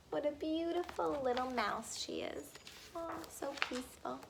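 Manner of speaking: put-on hushed, high coo over a sleeping baby; soft cooing. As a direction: murmur it softly, in wonder.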